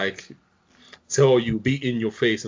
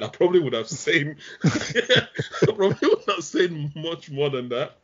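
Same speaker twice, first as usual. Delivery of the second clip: talking through a laugh, higher; 7 semitones higher than usual. Real, not acted. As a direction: chuckle it out in hindsight. Laughing about hindsight.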